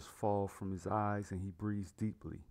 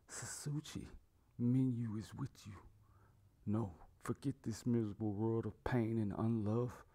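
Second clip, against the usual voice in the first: grieving; 2 semitones higher than usual. A narrator's reading. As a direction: mourn your child, starting hushed. Grieving father speaks to dead daughter.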